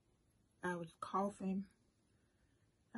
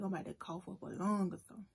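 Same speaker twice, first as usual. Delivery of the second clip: ordinary talk, nothing marked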